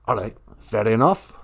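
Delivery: lightly voiced spirit with an odd accent; Great Spirit character. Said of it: "accent is from".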